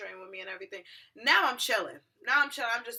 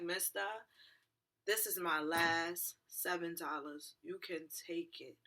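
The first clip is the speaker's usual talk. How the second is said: slow, soft, weary pleading; subdued plea; 3 semitones lower, 5 dB quieter, slower than usual. Retold or imitated. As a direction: offer it quietly, drawing the words out. Acts out pleading with the driver.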